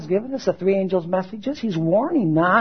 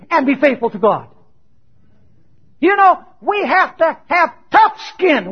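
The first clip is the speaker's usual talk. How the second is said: raised, challenging pulpit voice; forceful preaching; 7 semitones higher, 6 dB louder than usual. Acted. Preacher challenging church members who get offended, forceful. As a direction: challenge the congregation loudly.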